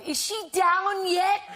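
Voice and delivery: high, loud call across a room; yelling. Retold or imitated. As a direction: yell it from far back.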